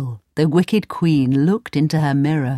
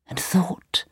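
whispered, secretive; thinking aloud in a whisper; 7 dB quieter than usual. A narrator's reading. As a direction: hush it like a secret.

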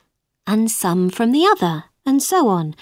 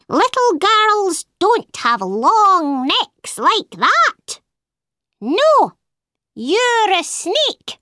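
higher, slow bird voice sure of itself; accusing bird; 7 semitones higher, slower than usual. A narrator's reading. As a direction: lay out the case slowly like a smug bird. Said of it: "said the bird".

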